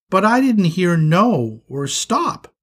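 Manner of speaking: lightly voiced little kid; a touch of puzzled innocence; innocent protest.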